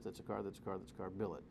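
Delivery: low, soft droning tally; flat robotic count, barely voiced; mock machine voice. Host mocking the switch that bills every car, droning. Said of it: "that switch that says".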